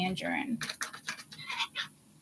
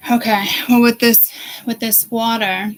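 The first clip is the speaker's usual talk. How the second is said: sudden loud burst; 9 dB louder than usual. Real, not acted. Sudden loud emphatic restart.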